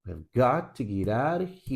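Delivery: "We have got to get out of here" with beats on GOT, OUT, HERE; three stressed pulses. Shown as a demonstration. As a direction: stress "got", "out", "here" and murmur the words between. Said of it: "Três pulsos".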